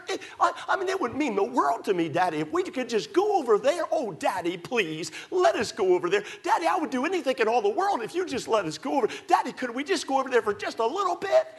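put-on high pleading kid voice; begging child. Child pestering Daddy to go somewhere, high begging. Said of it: "And they".

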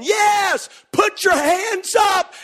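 put-on high, slow kid's cheer; mock kids cheering up high; excited kids. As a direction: yell it like a thrilled kid on a coaster. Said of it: "crazy kids behind me saying".

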